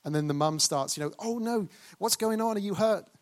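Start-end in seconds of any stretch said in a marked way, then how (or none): 1.2-3.1 much higher, overdone alarm; dramatic worried mum. Retold or imitated. Mum overreacting to her fallen child, higher and alarmed. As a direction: play the panicking mother, hammed up. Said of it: "And it gets all dramatic".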